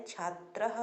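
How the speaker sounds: छात्रः with a clear breathy ह echo after the final a; visarga sounded. Shown as a demonstration. Teacher showing students to sound the visarga: careful breathy ending. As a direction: pronounce the visarga in छात्रः as a soft aspirated ह. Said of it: "ये जो विसर्गें लगी हैं, इनको भी पढ़ना है".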